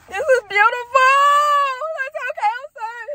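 high, choked-up cry of joy; tearful outburst. Real, not acted. Viewer moved by the proposal, tearfully overwhelmed. Overcome watching the proposal, apologises.

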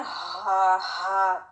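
ordinary talk

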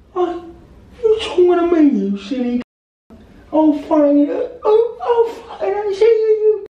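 very high, strained hooligan squawk; mock football fan. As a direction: puff up like a fan spoiling for a fight. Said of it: "football fans, they go".

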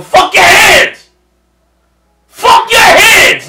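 loud, high, slow outraged yell; shouting at the screen. Real, not acted. Reacting to the anime, yelling at the screen in outrage. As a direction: yell it out in disbelief.

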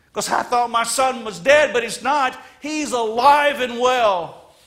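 high, loud proclaiming father's joy; joyful father. Acted. Father calling servants to celebrate, loud and joyful. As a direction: shout the father's celebration to the crowd.

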